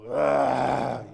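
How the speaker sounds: a big loud grunt of frustration. Retold or imitated. A man at thirty, still fired up, grunts loudly. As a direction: blurt out a sudden loud ugh. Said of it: "I'm still".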